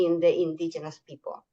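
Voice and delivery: usual speaking voice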